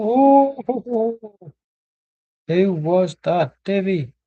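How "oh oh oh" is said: excited oh. Real, not acted. Reacting to a chance.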